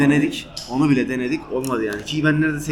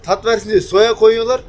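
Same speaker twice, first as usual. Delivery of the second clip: higher, louder and slow; emphatic restart; 8 semitones higher, 8 dB louder than usual. Real, not acted. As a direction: say it again with full emphasis. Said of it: "Ha şey diyordum".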